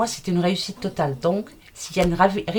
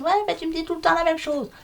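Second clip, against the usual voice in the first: high, fast, whiny mock viewer; 9 semitones higher, faster than usual. Reader imitating viewers who complain she repeats herself, high, fast and whiny. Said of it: "Il y en a qui me disent".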